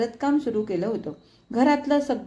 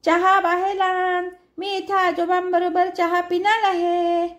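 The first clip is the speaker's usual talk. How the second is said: put-on higher, louder, slow childish shout; boy shouting back; 9 semitones higher, 4 dB louder, slower than usual. A narrator's reading. Boy shouts back from the swing, stubborn childish shout. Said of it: "तो तिथून ओरडे".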